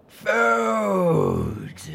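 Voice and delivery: high, loud creature bellow. Acted. A hungry dragon bellows at the ponies, loud. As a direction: roar it hungrily. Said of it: "one of the dragons bellowed".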